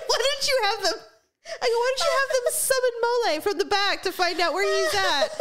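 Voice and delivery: high, giggling delivery of the joke; laughing through words. Real, not acted. Joking to Greg about summoning Mole, laughing through it. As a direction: barely get the punchline out through laughter.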